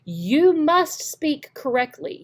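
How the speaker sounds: "You" stressed, said slowly and firmly. Real, not acted. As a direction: lean on "You" and slow right down. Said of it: "the grammar starts with you".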